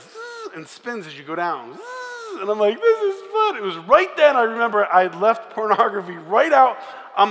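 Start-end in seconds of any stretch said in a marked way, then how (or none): 0.0-0.5 high thin buzzing zip; imitating the ride spinning down, high buzz; ride noise. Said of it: "it goes like this". 1.7-2.4 high thin buzzing zip; imitating the ride spinning down, high buzz; ride noise. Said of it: "it goes like this". 2.8-3.6 very high, loud squeal; on the ride with Melissa, high excited squeal; thrilled shout. Retold or imitated. Screaming on the ride.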